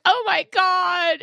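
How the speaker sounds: very high, slow, stretched horrified squeal; horrified daughter. Retold or imitated. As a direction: drag it out like a mortified kid. Mom imitating daughter.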